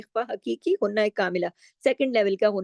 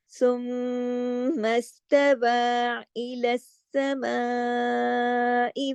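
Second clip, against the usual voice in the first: slow melodic tajweed recitation; measured Quranic tilawat; much slower than usual. Acted. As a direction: chant the verses slowly and evenly. Recites Quran verses slowly.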